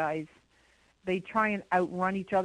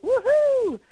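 high, loud party whoop; 9 dB louder than usual. Retold or imitated. Caller mimics somebody whooping at a party, high whoop. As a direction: whoop like someone partying in the woods. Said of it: "like somebody was like".

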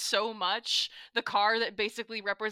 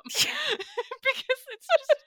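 laughing; 13 semitones higher, slower than usual. Real, not acted. Laughing while describing the show.

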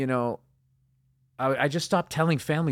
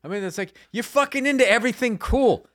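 raised high and loud, fed up; venting his frustration at her; exasperated rant; 8 semitones higher, 7 dB louder than usual. Real, not acted. Husband venting about his wife, exasperated and loud.

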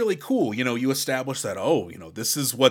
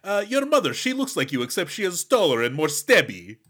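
imitated Russian bad guy; bad Russian accent; 3 semitones higher than usual. Bad guy talks to Sam about her mother, mock Russian accent. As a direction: put on a hammy movie-villain Russian accent. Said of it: "do an impression of this character".

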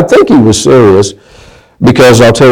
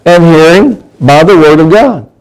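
higher, fervent preaching voice; 4 semitones higher than usual. Acted. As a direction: preach it out to the congregation. Preaches scripture at full force.

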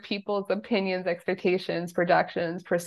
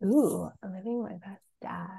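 hushed, mulling it over; musing whisper; 4 dB quieter than usual. Real, not acted. Guest pondering the host's question, musing in a whisper. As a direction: whisper it to yourself as you ponder.

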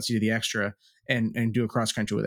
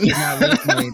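laughing; 7 dB louder than usual. Real, not acted. Joking denial, laughing.

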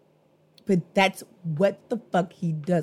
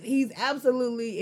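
ordinary delivery

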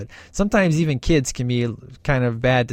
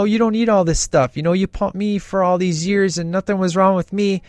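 put-on higher, pleading kid voice complaining to mom; whiny kid's protest; 5 semitones higher than usual. Kid complaining to his mom about medication, mock whiny protest. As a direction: whine like a kid fed up with his meds.

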